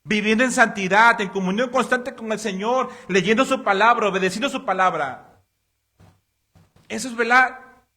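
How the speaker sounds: fuerte y alto, exhortando a la congregación; predicación a toda voz. Acted. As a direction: clama como pastor desde el púlpito. Predica con fuerza. (el pastor exhorta a la congregación, predicando a toda voz)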